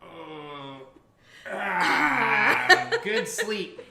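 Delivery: high laughing yawn of a fake sleeper; mock waking up, laughing. GM teasing the player's fake waking, laughing.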